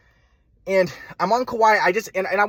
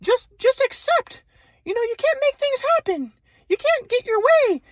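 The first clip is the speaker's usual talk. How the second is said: much higher, slow, mock-serene old man; mock grandpa voice; slower than usual. Grandson mocking his grandpa's advice, high and slow. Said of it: "he's like".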